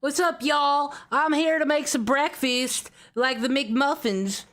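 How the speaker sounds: hammed-up Indiana accent, mostly guessed; attempted Indiana accent. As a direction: play a cheery Midwestern Indiana local. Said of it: "like, an Indiana accent".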